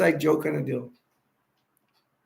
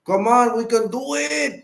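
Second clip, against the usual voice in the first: rallying, pitch jumping up; rally the chat like a hype man; excited cheer for the fans; 6 semitones higher, slower than usual. Real, not acted. Rallying viewers to watch movie.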